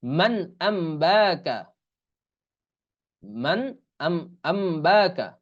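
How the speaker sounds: slow, drawn-out "man ambaka"; Quranic example recitation. Shown as a demonstration. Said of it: "উদাহরণ হলো এই যে". ("মান আম্বাকা" টেনে ধীরে পড়া)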